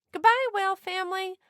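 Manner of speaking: a bright call out to sea; shouted goodbye. A narrator's reading. People waving off the whale family, fast loud farewell shout. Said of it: "they shouted".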